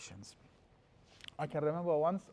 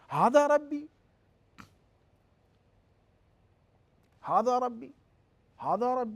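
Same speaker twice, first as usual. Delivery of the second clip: high, loud, incredulous "هَذَا رَبِّي"; questioning tone; 9 semitones higher, 8 dB louder, much faster than usual. Shown as a demonstration. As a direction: lift "هَذَا" as a disbelieving question. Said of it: "he said that as a question".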